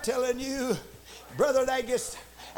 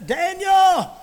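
loud, raised call into the den; lamenting cry; 5 semitones higher, 10 dB louder, much slower than usual. Acted. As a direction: cry out as the anguished king. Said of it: "he came in cry and lamentation".

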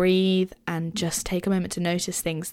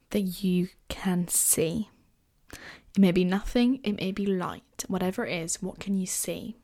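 guided meditation. Acted. Leads a short guided meditation.

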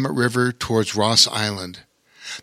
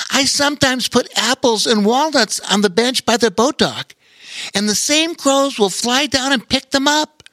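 high, bright friendly sailor; bright cheerful voice; 13 semitones higher than usual. Acted. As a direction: share it brightly and eagerly. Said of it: "brightly spoke up".

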